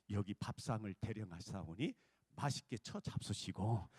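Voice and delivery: low, mock-courtly servant tone; humble letter voice. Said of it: "거기다 써놨단 말이야".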